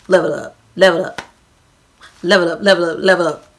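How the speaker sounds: mechanical chanting card voice, a bit fast; imitating a talking card. Vlogger imitating a greeting card that chants level up. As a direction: mimic the toy voice the card plays. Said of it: "when you open it goes".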